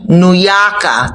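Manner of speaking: slow "New Yorker" with a dropped r, "Yawka"; New York accent, drop the r in "Yorker"; slow, lightly put-on New York accent; mock New York accent. Shown as a demonstration. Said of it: "sorry for the accent".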